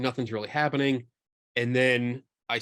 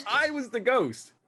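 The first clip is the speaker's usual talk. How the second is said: dramatic realization; 11 semitones higher than usual. Acted. Dramatic confession.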